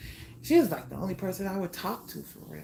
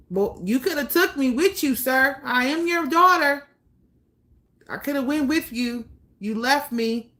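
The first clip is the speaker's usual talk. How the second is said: higher and louder, calling him out; angry retort; 6 semitones higher, 6 dB louder than usual. Pretend. Daughter talking back to the father who left her, angry and loud. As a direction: let him have it, raised and hurt.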